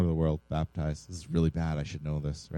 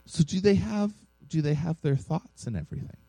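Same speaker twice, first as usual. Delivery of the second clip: higher-pitched, lightly voiced child's question; a hint of a small child, pitched up; retold six-year-old; 10 semitones higher than usual. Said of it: "this is to a six-year-old".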